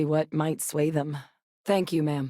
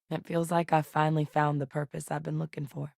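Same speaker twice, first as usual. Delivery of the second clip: ordinary talk, nothing marked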